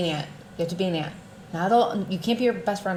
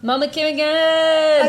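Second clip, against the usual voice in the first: high, loud, drawn-out hooray; big celebratory holler; excited cheer; 10 semitones higher, 8 dB louder, slower than usual. Real, not acted. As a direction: announce the guest with a slow whoop. Cheering the returning guest's name.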